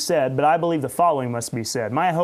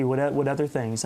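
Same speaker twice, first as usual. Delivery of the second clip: ordinary talk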